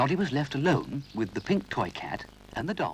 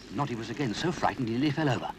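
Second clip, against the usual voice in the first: usual speaking voice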